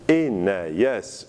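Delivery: "enayas" with a y glide between the vowels; glide inserted. Shown as a demonstration. Teacher showing glide insertion, "enayas" with an audible y. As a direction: slide through a y in "enayas". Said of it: "avec insertion d'un glide".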